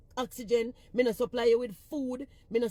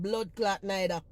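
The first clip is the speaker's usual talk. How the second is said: ordinary delivery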